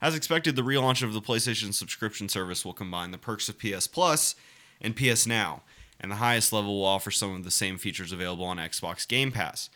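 reading the article aloud in a steady report voice; news article reading. Acted. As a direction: read it like a news piece.

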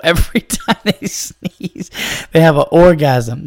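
laughing. Real, not acted. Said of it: "I'm crying of laughter".

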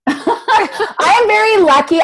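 giggling gratitude; a real laugh carrying the words. Real, not acted. Laughing about her husband.

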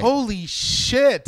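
drawn-out stunned holy shit; amazed. Real, not acted. Reacting to stunt.

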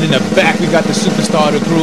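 loud, pumped-up podcast intro call; hype roll call. Acted. Host hypes the crew in the opening roll call, loud and pumped.

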